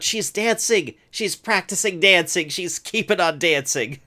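high-pitched, hammed-up excited announcement; mock excitement. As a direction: hype it up like big news, pitched high. Hyping up a repeated story beat.

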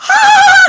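loud, high silly shriek; goofy scream. Retold or imitated. Cousins panicking together over their secret, goofy high scream. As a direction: shriek like a giddy, nervous kid. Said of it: "goofy, silly".

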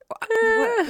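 high, loud startled What?; a husband jolted out of his sulk. Retold or imitated. Husband startled at the wheel, high and loud. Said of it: "he's just like".

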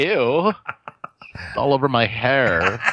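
put-on slow, drawn-out valley girl whine. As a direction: play the grossed-out valley girl. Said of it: "You sound like a valley girl".

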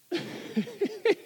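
high burst of laughter; laughing at the punchline; amused laugh mid-story; real laugh. Real, not acted.

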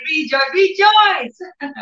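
slow, high, ringing rejoice; drawn-out preaching shout. Acted. Preacher urges listeners to rejoice, slow high shout. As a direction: stretch each rejoice like a call.

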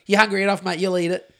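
ordinary delivery

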